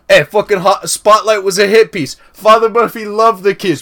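loud, high-pitched imitation Boston accent. Quoting the best bit to friends, loud Boston accent. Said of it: "they're doing, like, a, the Boston accent".

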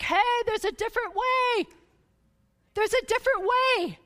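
a mock cheery old woman. Grandmother in the sermon announcing a new way, mock cheerful. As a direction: chirp it out like a spry old lady. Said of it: "she's like".

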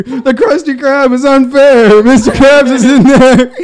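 loud, slow tipsy rant; hammed-up drunk at dinner. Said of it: "three drinks later".

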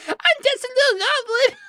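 very high goblin squeak; pitiful little goblin. Acted. Echoing the little goblin to guilt players, pitiful. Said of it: "you make your players feel bad".

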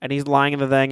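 normal talking voice

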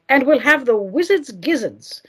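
ordinary delivery

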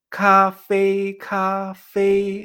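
CAFÉ alto, plano y alargado, como robot. Shown as a demonstration. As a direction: habla como un robot, sin mover el tono. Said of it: "Estoy hablando como si fuera". («café» en monotonía robótica y sostenida)

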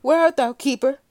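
loud, high call across the cell; startled waking call for help. Acted. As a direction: shout for the keeper in alarm. Clarence calls out on waking.

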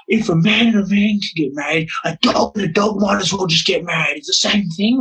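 hammed-up teacher ranting to the girls. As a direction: play the teacher's outraged comparison. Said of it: "He goes to them".

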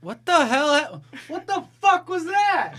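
high, loud, drawn-out disbelief; shocked. Real, not acted. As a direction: shout it out in sheer shock. Reacting to sudden teleport.

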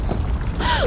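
startled high gasp; sharp gasp. Acted. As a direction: gasp in sudden fright. Sudden danger.

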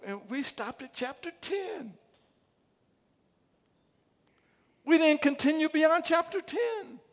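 rising to a loud, high preaching shout. Real, not acted. Preacher crying out to the church about unfinished lives. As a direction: build it up until you are shouting at the congregation.

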